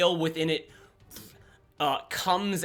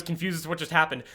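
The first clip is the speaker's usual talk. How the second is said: ordinary delivery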